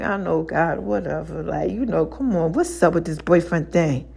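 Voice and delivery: low, soft dismissive reply; grudging mumble. Retold or imitated. Answering God's scolding with a low mumble. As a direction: shrug it off under the breath.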